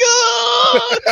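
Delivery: high, loud hammed-up cry; mock anguished wail. Fan reliving the train scene, mock anguished wail. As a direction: let out an exaggerated wail. Imitates own reaction to crying scene.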